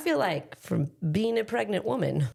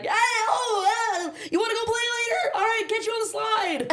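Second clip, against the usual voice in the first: put-on cheery kid voice; 14 semitones higher than usual. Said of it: "walking like".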